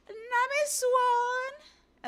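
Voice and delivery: loud, high drawn-out swooning cry; very high, lovestruck holler; Sanji. Acted. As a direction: sing out the name from afar, stretching it long. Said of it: "and it's Sanji".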